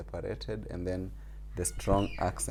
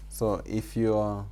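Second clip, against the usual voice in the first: normal talking voice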